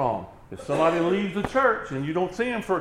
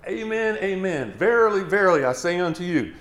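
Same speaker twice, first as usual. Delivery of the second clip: "Amen, amen, verily, verily" pitched up, VERILY loud; emphatic repetition; 5 semitones higher, 6 dB louder than usual. Shown as a demonstration. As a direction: hit both words of "Amen, amen, verily, verily" high and strong. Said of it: "two words like that said for emphasis".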